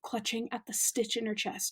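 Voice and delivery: ordinary delivery, nothing marked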